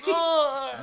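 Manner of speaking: high, drawn-out groan of pain; cartoonish wail of someone hurt; mock pained groan. Retelling a cartoon man shooting himself with a nail gun, mock pained groan.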